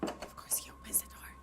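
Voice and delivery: hushed, breathless whisper at the screen. Real, not acted. Viewer whispering at a horror video. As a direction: whisper it like you're afraid to be heard. Whispering while watching a scary clip.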